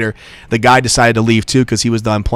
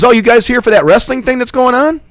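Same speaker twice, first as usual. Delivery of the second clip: much higher, lively questioning voice; retold question in a raised voice; curious stranger, imitated; 12 semitones higher, 3 dB louder than usual. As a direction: voice a nosy bystander asking, pitched up.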